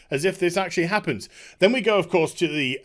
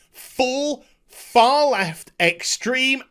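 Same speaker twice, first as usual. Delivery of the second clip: normal talking voice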